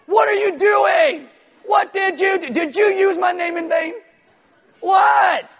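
high, loud mock-outraged shout; all caps. Shown as a demonstration. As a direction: yell it like an angry email. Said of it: "or all caps".

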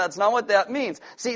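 normal talking voice

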